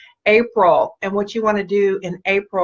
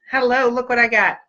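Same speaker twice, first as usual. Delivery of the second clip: ordinary delivery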